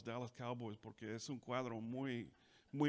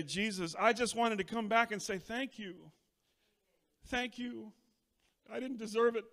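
grateful prayer; 7 semitones higher, 5 dB louder than usual. Shown as a demonstration. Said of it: "come before him and say".

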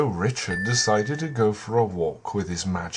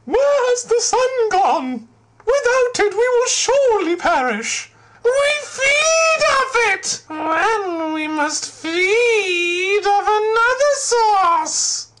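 shrill cries, rising to a shout; panicked high voice; 8 dB louder than usual. Acted. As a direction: wail in terror for the sun. Flowers panic in the dark.

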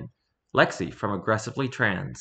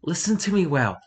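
intense whisper; 5 semitones higher than usual. Pretend. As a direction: lean in and whisper it. Whispered earnest aside.

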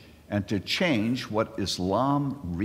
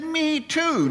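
high, excited "Me too."; eager me too. Retold or imitated. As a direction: pop it up high, delighted. Joke, speaker's eager reply.

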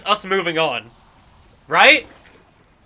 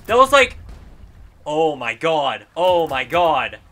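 ordinary talk, nothing marked

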